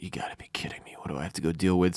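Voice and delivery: exasperated whisper under the breath; annoyed muttering. Retold or imitated. Imitating Aemond annoyed at having to deal with it, exasperated whisper. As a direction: whisper it like a put-upon grumble. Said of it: "an annoyed look on his face".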